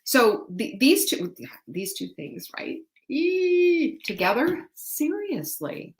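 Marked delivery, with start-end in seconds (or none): 3.1-5.9 excited squeal. Real, not acted. Excited about two products together.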